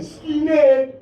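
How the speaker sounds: loud, high, drawn-out call. Real, not acted. Comedian hails the skinhead in the audience, loud call. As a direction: holler it out at the heckler. Calling out to a heckler.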